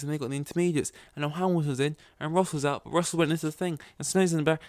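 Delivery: high-pitched, breathless-fan race reaction; excited play-by-play. Retold or imitated. Fan reliving the pit stops, high excited retelling.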